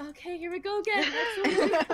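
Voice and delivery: laughing order taker. Retold or imitated. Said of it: "And I'm like".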